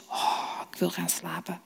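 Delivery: drowsy yawning whisper, almost no voice; old Eli yawning, wanting to sleep; sleepy yawn. Acted. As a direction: yawn it out, half asleep. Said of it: "En Eli geeuwt".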